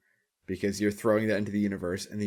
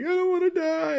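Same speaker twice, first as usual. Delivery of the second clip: much higher, panicky whining plea; mock panicked whine; 4 dB louder than usual. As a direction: squeal it like a cornered coward. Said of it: "they freak out and they're like".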